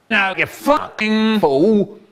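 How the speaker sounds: slow high sneering snarl; mock furious put-down; angry man voice. Man's angry retort repeated.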